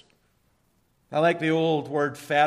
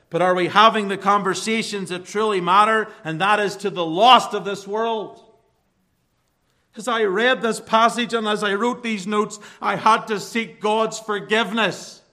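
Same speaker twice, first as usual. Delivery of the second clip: rising impassioned sermon; preaching; 4 semitones higher, 5 dB louder than usual. Acted. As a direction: build the plea higher and louder. Preacher builds to climax.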